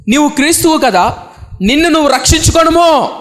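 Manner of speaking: high-pitched taunting challenge; reviling. Retold or imitated. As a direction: imitate a thief jeering from the cross. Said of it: "ఆయనను దూషించుచు".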